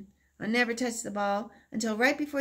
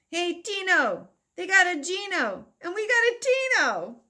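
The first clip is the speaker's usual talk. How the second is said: mocking taunt; 9 semitones higher, 5 dB louder than usual. Boys teasing, mocking rhyme.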